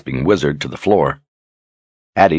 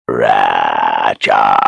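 beast voice; 11 semitones lower than usual. Acted. Beast answers in its own tongue.

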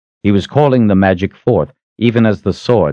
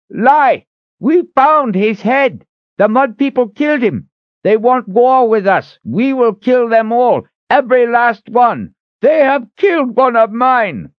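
very high, loud ranting voice; high-pitched, outraged war cry; enraged old leader; 4 dB louder than usual. Acted. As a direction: shriek accusations in an old man's fury. Old leader rages at Richard.

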